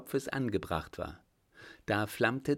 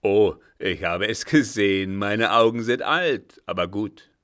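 put-on much louder, crowing old man; loud insistence; 11 dB louder than usual. A narrator's reading. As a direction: insist at full voice that you saw it. Old man insists loudly he saw. (lautstark auftrumpfend)

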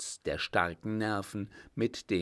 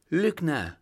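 higher, louder accusation; indignant outburst; 7 semitones higher, 7 dB louder than usual. A narrator's reading. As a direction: hurl the insult at him. Lady hurls insult at accuser. (empörter Ausruf)

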